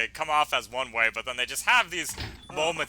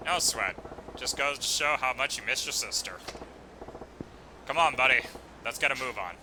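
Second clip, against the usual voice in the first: a hint of a calm tough guy, pitched down; easygoing big guy; 7 dB quieter than usual.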